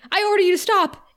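mock boss pulling rank; commanding order. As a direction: bark the order after him. Walsh ordering him to stop.

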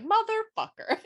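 high-pitched curse breaking into laughter; indignant high squeal of a curse; mock outrage. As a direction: curse in hammed-up disbelief, half laughing. Voices heroine learning he knew.

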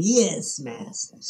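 a lighter grovelling yes master; quick servile hunchback echo; hunchback assistant. Retold or imitated. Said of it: "they got the hobble and they're walking around".

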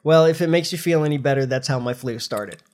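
usual speaking voice

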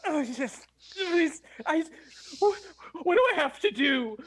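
high mock groveling; pathetic captive. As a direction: grovel eagerly in a hammed-up whine. Keeps up pathetic act to captors.

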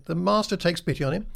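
ordinary talk

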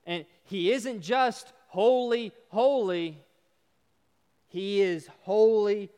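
loud, slow, weighty proclamation; forceful preaching. Real, not acted. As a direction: preach it loudly, pressing on each phrase.

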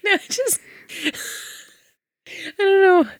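high, giggly nervous laughing. Real, not acted. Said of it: "I think it's nervous laughter".

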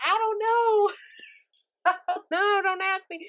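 high, whiny, flustered; high mock-whimpering protest; mock whine. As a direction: play the helpless girl who doesn't know. Acting flustered when asked for advice.

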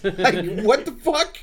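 high, loud disbelieving yell; outraged yell. Retold or imitated. Friend reliving his outrage at the guy, loud and high. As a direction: blow up at the absurd answer.

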